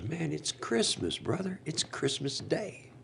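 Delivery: lightly voiced stranger, slow and high; homeless guy. Homeless guy quoted in story.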